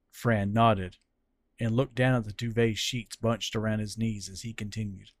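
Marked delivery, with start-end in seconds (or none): none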